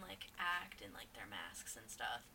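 low, unvoiced spooky whisper. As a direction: whisper it like a ghost story.

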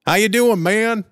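high, loud imitation of a stranger's greeting; gamer's voice. Clan member greets him through the TV, high loud mock greeting. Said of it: "hear this voice".

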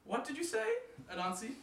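high, puzzled voice; wife asking. Acted. Wife calling back to Anansi, puzzled. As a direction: she can't hear him.

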